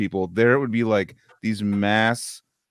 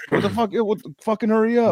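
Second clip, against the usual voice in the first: shrill impatient clerk, pitched way up; high mock yell; 12 semitones higher than usual. Subway worker rushing a customer, high mock yell. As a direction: snap at the customer to hurry, hammed-up. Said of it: "yelled at me".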